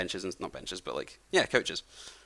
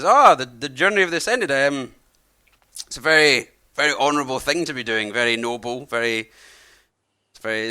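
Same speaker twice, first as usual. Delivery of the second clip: put-on friendly old man; 2 semitones higher, 5 dB louder than usual. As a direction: play a chatty old host welcoming travellers. Old man praises pilgrimage.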